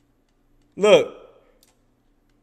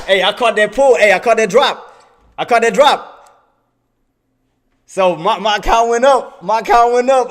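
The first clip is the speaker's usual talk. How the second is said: loud, higher, jubilant repeats; elated bragging; 4 semitones higher, 6 dB louder than usual. Real, not acted. Trader celebrates the account going up, elated. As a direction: crow about the win.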